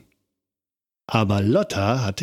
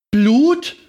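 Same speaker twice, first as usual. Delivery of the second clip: very high, louder shriek of alarm; horrified scream; 13 semitones higher, 6 dB louder than usual. A narrator's reading. Lotta sees blood on her leg and screams. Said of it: "schrie Lotta laut".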